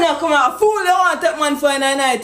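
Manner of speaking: ordinary talk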